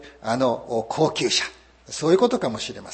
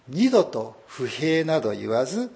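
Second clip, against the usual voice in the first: ordinary talk, nothing marked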